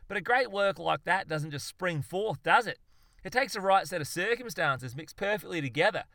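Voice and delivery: high, loud, eager explanation; gleeful expounding. Acted. Zoologist expounds his great idea, gleefully. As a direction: carry your mate along with excited zeal.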